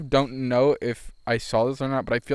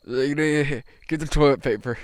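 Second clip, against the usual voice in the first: slow, laboured grumble; grumbling old man; 3 semitones higher, slower than usual. Retold or imitated. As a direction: imitate a man struggling for breath to speak. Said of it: "he grumbled because he j".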